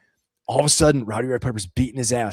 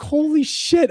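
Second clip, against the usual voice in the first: excited fanboy; 14 semitones higher, much slower than usual. Retold or imitated. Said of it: "I was like".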